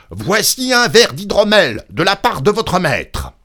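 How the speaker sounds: loud, high, forceful shout; cri à pleine voix. A narrator's reading. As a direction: yell it at someone deaf. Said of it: "cria-t-il de toutes ses forces". (cri forcé, fort et aigu)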